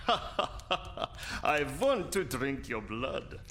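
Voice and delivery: drawn-out laughing vampire threat; slow gloating vampire. Acted. Vampire who won the game, slowly threatening Hans. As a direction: savor each word, laughing. Vampire wins, moves to bite.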